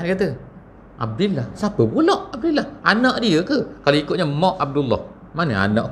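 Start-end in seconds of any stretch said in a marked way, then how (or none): 1.0-3.6 high, baffled question with a laugh; puzzled surprise. Acted. Bystander puzzled about who Abdullah is, high surprised question. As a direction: ask it high, half-laughing and confused. Puzzled hearer of Aisyah's name.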